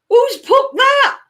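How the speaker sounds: high, loud, slow shout; loud indignant demand; mock outrage. Real, not acted. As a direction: shriek in playful indignation. Mock outrage at chat comment.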